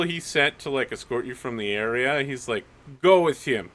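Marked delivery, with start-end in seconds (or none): 3.0-3.7 loud, higher-pitched command; acted officer ordering a guard; corporal's order. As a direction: give a subordinate a firm order. Corporal orders his man.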